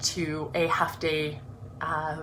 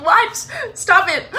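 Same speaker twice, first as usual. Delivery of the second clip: much higher, louder mock protest; mock disbelief; 13 semitones higher, 8 dB louder than usual. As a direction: scold herself for crying, laughing. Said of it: "I'm like".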